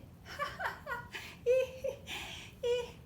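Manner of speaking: high giggling laugh; high laughter. Acted. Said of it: "they're a riot".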